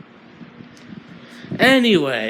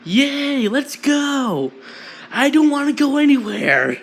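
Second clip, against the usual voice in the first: screaming; 11 semitones higher than usual. Acted. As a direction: scream it out like a manic kid. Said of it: "Twice screaming".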